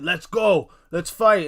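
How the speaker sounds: hyped-up imitation of a challenger; acted challenge. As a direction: call someone out to brawl, playacted. Acts out attacker's challenge.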